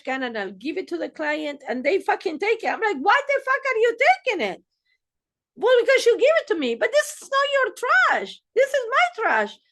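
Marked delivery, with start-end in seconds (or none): 3.0-4.6 high, fast, exasperated squawk; mock exasperated outburst. Acting out scene with client. 5.6-6.7 high, fast, loud, innocent client voice; mock innocent client. Client's reply in role play. 6.7-9.6 high, loud, hammed-up scolding; mock scolding. Acting out scene with client.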